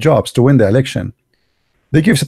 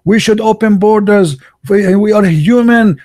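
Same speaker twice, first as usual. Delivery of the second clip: raised mock-earnest chant of open borders; mocking liberals; 8 semitones higher than usual. Mocking liberals at the border debate, raised mock-earnest voice. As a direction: parody the liberals, pitch climbing. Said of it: "They talk about borders".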